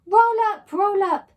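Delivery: loud, high hawker's cry; market shout. A narrator's reading. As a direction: bellow it like a game show host selling perfume. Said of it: "by shouting".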